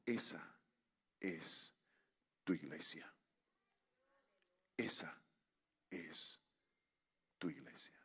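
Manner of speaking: very low, soft, word by word; hushed revelation. Retold or imitated. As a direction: say it gently with a pause after each word. The Lord's quiet revelation, retold.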